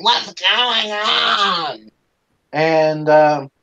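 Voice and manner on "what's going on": higher, hugely drawn-out cry; alarmed grandmother. Retold or imitated. Grandmother runs over to the crying girl, drawn-out alarmed mimicry. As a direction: mimic a fussing grandmother, stretching every word.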